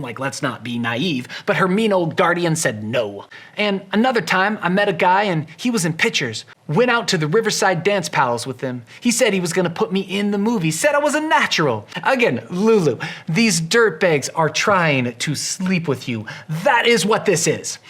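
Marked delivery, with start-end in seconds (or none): 3.6-11.9 lightly voiced naive girl, higher and louder; a hint of a dreamy small-town girl; mimicking Lulu. Mimics Lulu retelling her past. 12.0-17.8 mock scolding, louder; exasperated aside. Real, not acted. Scolds the character directly.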